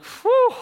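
high, loud whew of relief; acted relieved whew. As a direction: let out a big mock sigh of relief. Relief as the car leaves.

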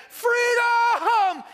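soaring, high, loud war cry of freedom; Braveheart battle cry. Retold or imitated. Preacher quoting the film hero's cry to the congregation, full shout. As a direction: throw your head back and roar it like a rebel leader. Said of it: "thinking of that scene in Braveheart".

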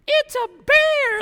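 high, loud, drawn-out mock scream; very high, loud, slow mock shriek of bear panic; terrified shriek. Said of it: "like that".

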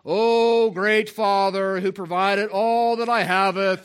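loud, slow, high, pompous King James prayer; mock formal prayer. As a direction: ham up an archaic, grand prayer voice. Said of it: "my son Joel ... said".

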